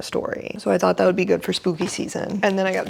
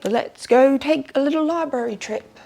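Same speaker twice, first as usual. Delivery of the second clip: put-on playful British accent, higher and louder; a cheerful British accent announcing the trip; 6 semitones higher, 4 dB louder than usual. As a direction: chirp it out in a posh British accent. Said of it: "I'm doing the British accent a lot".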